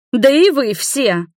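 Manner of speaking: slow rebuke with "все" emphasised; stress on все. A narrator's reading. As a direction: drag out and punch the final "все". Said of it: "выделяя последнее слово".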